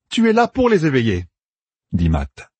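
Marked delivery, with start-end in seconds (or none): 0.1-1.3 lightly raised, eager commander; planning aloud with brisk confidence; a hint of a young general laying out plans; Matt's voice. A narrator's reading. Narrator voices Matt.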